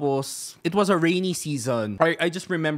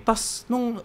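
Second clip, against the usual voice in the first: normal talking voice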